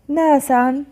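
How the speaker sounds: ناسا read with a final -an; tanween fath. Shown as a demonstration. Teacher reading out the word list, tanween fath on ناسا. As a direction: sound out ناسا as "naasan". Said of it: "فتح".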